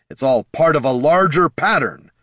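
loud scream of accusation. A narrator's reading. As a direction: shout the words out. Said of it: "Jin's screams".

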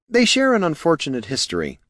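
high-voiced, careful admission; reluctant concession. A narrator's reading. Helper explaining the mages' feud, high reluctant concession. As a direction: admit it delicately, pitch starting high and falling. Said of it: "Niln conceded".

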